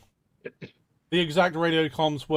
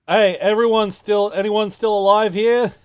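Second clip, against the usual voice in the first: hollering for survivors, higher and louder; calling out; 7 semitones higher, 8 dB louder than usual. Retold or imitated. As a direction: shout out to find anyone alive.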